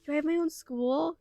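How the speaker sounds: high, amazed questions. Real, not acted. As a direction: gasp it out in wonder. Discovers her own school.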